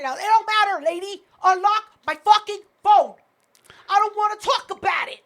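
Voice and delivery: impatient retold demand, punching the words; retold yelling. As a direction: reenact snapping at a clerk. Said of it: "Yelling at this poor girl".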